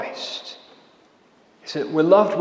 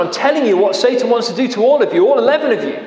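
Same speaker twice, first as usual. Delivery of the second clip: urgent warning; 8 semitones higher, 4 dB louder, faster than usual. Acted. Preacher voicing Jesus' warning.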